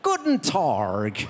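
slow German in a West Country accent. Shown as a demonstration. Comic speaks German in a West Country accent. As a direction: drawl Guten Tag West Country style. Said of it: "German with a West Country accent".

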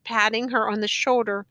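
ordinary delivery

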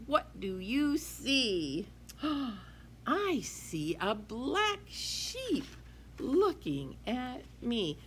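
put-on lively sing-song read-aloud for kids; reading to children. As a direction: read it bright and bouncy to a class. Teacher reads picture book aloud.

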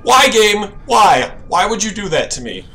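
loud frightened pleading; scared whine. Real, not acted. Complaining to the game.

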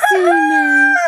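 squeaky very high call. Real, not acted. Calling her duck Suna, squeaky high.

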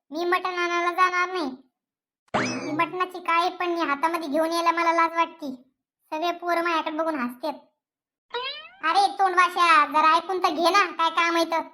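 usual speaking voice

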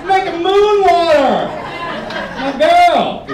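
high, loud hype-man holler; rallying shout. Acted. Partner rallying the street for moon water, rallying shout. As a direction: call the whole street over, proudly.

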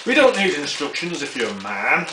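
ordinary talk

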